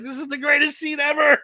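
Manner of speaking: high, excited squeal of delight; gushing. Retold or imitated. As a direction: gush like a thrilled fan. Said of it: "I was like".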